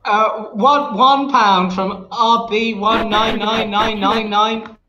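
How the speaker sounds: slow, high robotic read; text to speech voice. Pretend. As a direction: read the name like a TTS bot. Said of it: "I'll read it in a text to speech voice".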